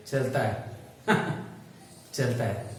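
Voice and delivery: low, soft mutter broken by a short laugh; resigned wry aside. Acted. Citizen used to false promises shrugs them off, quietly resigned.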